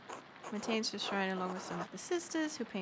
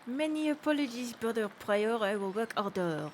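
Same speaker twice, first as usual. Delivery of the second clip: put-on French accent. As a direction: apologize in a hammed-up French accent. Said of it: "to do a French accent for him".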